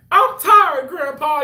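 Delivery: put-on high, loud whiny kid's complaint; social media meme quote. Coworker quotes a meme at work, hammed-up high whine. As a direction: wail it like a worn-out grandchild. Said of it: "that whole thing they do on, like, social media".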